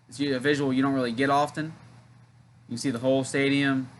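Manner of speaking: ordinary delivery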